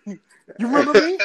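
high, loud gloating question through laughter; pretend taunting. Former pupil confronting old teacher in store, mock gloating. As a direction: crow it at him, laughing.

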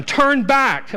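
high, loud command; Naomi pleading. Acted. Naomi telling her daughters-in-law to turn back, loud command. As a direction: send them away firmly. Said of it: "But Naomi said".

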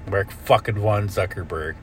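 ordinary talk, nothing marked

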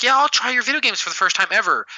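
mocking girly voice. Mocking girls to gamer guys.

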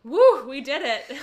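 loud, high-pitched victory whoop; cheering. Real, not acted. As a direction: celebrate finishing at full volume. Cheering at finishing the recap.

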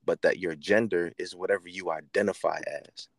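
usual speaking voice

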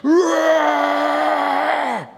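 high shouted roar of an army; mock battlefield war cry; army battle roar. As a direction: roar like soldiers charging into battle. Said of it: "a loud army on a battlefield going".

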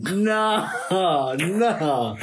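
high laughing protest. Real, not acted. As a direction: laugh it off in disbelief. Laughing refusal.

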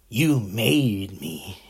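a slow, drawn-out, menacing hint of the Joker. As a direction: drag out each word like a villain savouring it. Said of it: "like the Joker told Batman".

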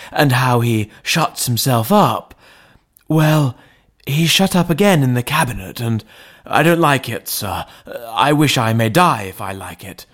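put-on an anxious old butler pleading with his master; frightened butler. A narrator's reading. Butler confessing his fear to the lawyer, anxious voice.

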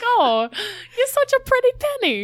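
high, laughing granny coo; doting grandparent. Acted. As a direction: croon it like a fond grandma. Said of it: "I'm like".

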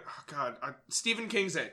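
normal talking voice

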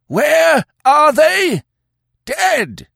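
drawn-out, very high, loud howl. Acted. Old chief answers his own demand before Conan, howling. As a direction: wail the charge at full pitch. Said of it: "howled the old chief".

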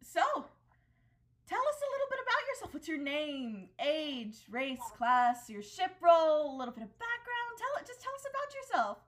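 high, bright interviewer voice, rushed toward the end; TV hostess. Acted. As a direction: play the chirpy talk show hostess. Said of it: "she turns to you and she goes".

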